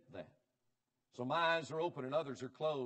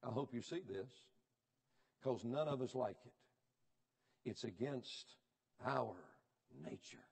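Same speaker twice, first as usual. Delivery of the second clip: ordinary talk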